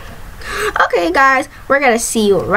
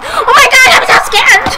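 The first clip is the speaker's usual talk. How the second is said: mock-scared high cry, half laughing; laughing while imitating her own scream; reenacting the panic; 11 semitones higher, 4 dB louder than usual. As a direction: replay the fright, hammed up. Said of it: "how upset I was".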